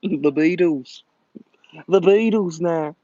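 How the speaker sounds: mock Scouse with stretched vowels; Liverpool accent. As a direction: put on a hammed-up Liverpool accent. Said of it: "impression of a Liverpool accent".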